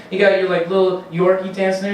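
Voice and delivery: ordinary delivery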